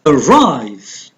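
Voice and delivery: Arrive with RIVE long, rising then falling; Arrive, a-RRIVE, careful teaching demo; stressed second syllable. Shown as a demonstration. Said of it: "going to pronounce louder and longer".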